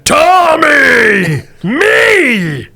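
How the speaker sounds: loud, high monster bellow of its own name; a hulking mutant yelling its name at the group; mutant roar. Acted. Mutant bellows its own name at the players, loud and high.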